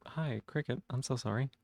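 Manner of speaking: ordinary talk, nothing marked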